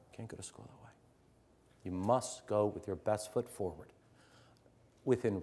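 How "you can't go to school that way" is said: whispered repeat. Shown as a demonstration. Teacher to staff, whispered repeat of the point. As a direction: say "can't" in a whisper, unvoiced and soft. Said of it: "repeats the line".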